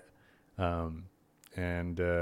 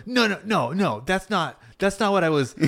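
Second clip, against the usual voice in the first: imitating flustered old woman; 11 semitones higher than usual. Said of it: "And she goes".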